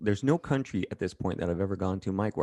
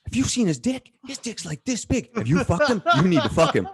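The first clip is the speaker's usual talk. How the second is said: high, loud, gushing fan voice, fast; hammed-up starstruck dudes raving; over the top fans; 14 semitones higher, 7 dB louder than usual. Fans raving to his date about him, over the top and high. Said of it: "it was so over the top".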